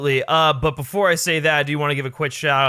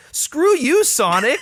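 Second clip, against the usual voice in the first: loud, high shout of annoyance; exasperated outburst; 11 semitones higher, 5 dB louder, much slower than usual. Retold or imitated. To Sonic on screen, an exasperated high shout. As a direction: yell it at Sonic, fed up. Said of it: "I'm like".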